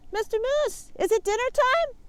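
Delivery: high squeaky little rabbit asking. Acted. Rabbit asks Moose.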